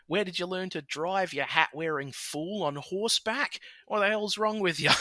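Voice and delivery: hammed-up old bloke yelling from his car; mock road-rage yelling. Older bloke yelling at a driver, mock angry. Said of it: "sort of vein of yelling at people".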